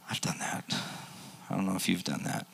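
whispered confession. Real, not acted. Pastor owning up to the congregation, in a whisper. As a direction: hush it like a secret.

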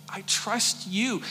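I trust you said slow and voiced, gently pleading; soft pleading. Hannah repeating her trust to God, soft pleading. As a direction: say it aloud this time, softly. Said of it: "but I can imagine".

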